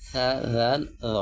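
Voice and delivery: সা, জাল, জ each begun with a "th" sound; tongue between the teeth on সা, জাল, জ; tongue-out articulation. Shown as a demonstration. Teacher reviewing three letters, সা, জাল, জ with tongue out. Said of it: "জিহ্বা বের করে উচ্চারণ করতে হবে".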